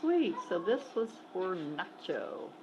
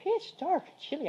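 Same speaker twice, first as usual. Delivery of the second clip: high emphatic exclamation; 8 semitones higher than usual. Real, not acted. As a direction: pitch dark, said high with emphasis. Showing the dark yard.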